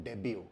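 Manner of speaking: डेब्यू said day-byoo, silent t; डेब्यू with no t at the end; correct pronunciation. Shown as a demonstration. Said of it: "इसको बोला जाता है".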